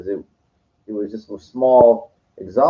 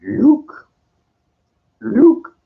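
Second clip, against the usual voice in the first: Yoda calling Luke; 13 semitones higher, 5 dB louder than usual. Retold or imitated. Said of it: "he goes".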